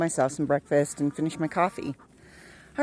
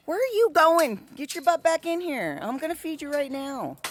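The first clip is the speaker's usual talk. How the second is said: high scolding; 8 semitones higher than usual. Real, not acted. Scolding chickens.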